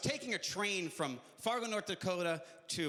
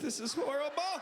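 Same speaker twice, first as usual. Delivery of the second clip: mock horror; 6 semitones higher than usual.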